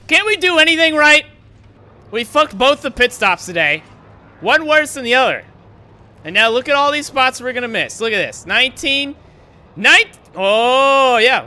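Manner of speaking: furious yell. Real, not acted. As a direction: blast the crew for botching it. Raging at pit crew mistakes.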